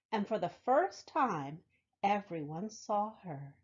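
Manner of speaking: lively storybook read-aloud; reading to children. Acted. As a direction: tell it warmly to a room of kids. Reads picture book to children.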